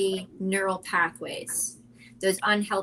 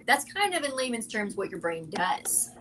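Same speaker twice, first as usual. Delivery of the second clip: ordinary talk, nothing marked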